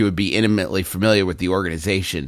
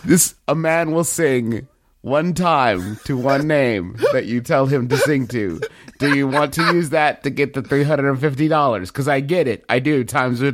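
Game of Thrones assassin; 3 semitones higher than usual. Pretend. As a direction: intone it like a hooded killer. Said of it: "Valar Morghulis".

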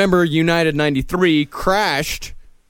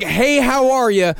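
big higher-pitched hello; cheery greeting; 7 semitones higher than usual. Acted. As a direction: greet the whole crowd with a lift. Quoting a standard cheery greeting.

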